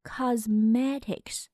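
cosmetics drawn out slowly and carefully; slow, measured model pronunciation of cosmetics; slow demonstration. Shown as a demonstration. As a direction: say cosmetics at a slow teaching speed. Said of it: "慢速读两遍".

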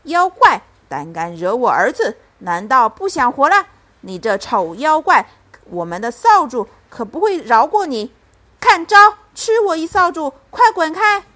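loud, fierce battle shout; roaring. A narrator's reading. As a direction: bellow threats like a protective mother. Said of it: "妈妈大吼一声".

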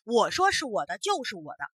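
loud, stubborn bullying; the foreigner bullies the court, loud and stubborn; unreasonable insistence. Acted. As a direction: bulldoze them with a flat refusal to reason. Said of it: "胡搅蛮缠地说".

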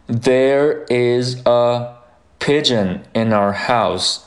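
slow, separated words in "there is a Pigeon in our house"; careful unlinked teacher reading. Shown as a demonstration. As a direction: give each word of "there is a Pigeon in our house" its full form, slowly. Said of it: "那么第一句就是".

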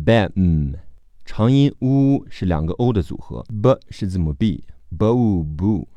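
usual speaking voice

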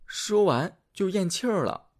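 usual speaking voice

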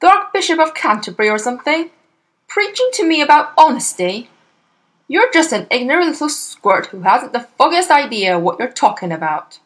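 loud, fast furious scolding; angry rant. A narrator's reading. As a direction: tear into the little squirt. Father's angry rant at daughter.

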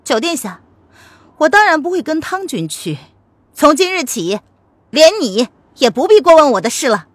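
put-on defiant young woman. Lili cuts ties with the prince, defiant.

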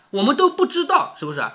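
usual speaking voice

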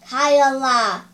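loud, slow, low pronouncement; booming, drawn-out ogre answer; granting pardon. Acted. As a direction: declare mercy, drawing out the words.

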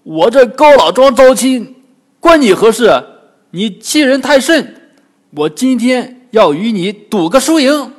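furious pig demon cursing the Monkey King; angry cursing. Acted. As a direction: bellow the insults, swinging the rake. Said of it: "那怪跳出骂道".

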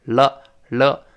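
了 with the tongue tip flicking forward off the ridge; teacher demos 了 for students, tongue flicked forward. Shown as a demonstration. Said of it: "再往前弹".

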